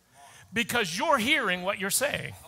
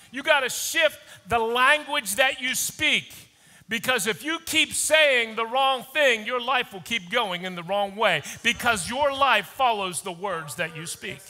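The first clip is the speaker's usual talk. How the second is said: usual speaking voice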